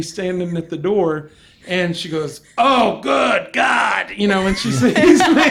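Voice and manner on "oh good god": drawn-out, exasperated exclamation; big, stretched-out cry of dismay; mock dismayed cry. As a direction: wail it slowly in horror at the sight. Acted dismayed attendant seeing the passenger.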